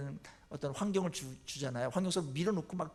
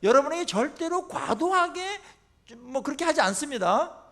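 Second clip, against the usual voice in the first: usual speaking voice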